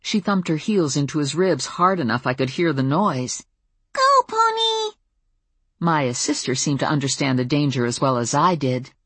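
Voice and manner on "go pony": gleeful preschooler voice; delighted little girl. A narrator's reading. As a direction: squeal at a pony as a tiny kid. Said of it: "Maya said happily".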